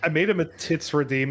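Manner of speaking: usual speaking voice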